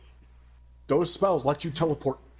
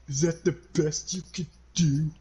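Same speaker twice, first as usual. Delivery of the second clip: mock-serious, higher put-on taunt; unfazed taunt; 4 semitones higher than usual. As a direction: act unfazed by a fatal hit. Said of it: "With a serious face as if it doesn't faze him".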